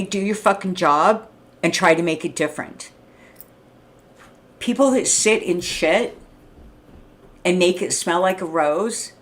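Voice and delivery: ordinary delivery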